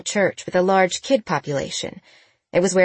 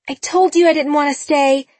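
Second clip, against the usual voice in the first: calling out; 9 semitones higher, 5 dB louder than usual. A narrator's reading. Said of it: "Sabrina called".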